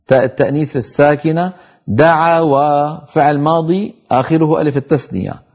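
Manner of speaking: ordinary talk, nothing marked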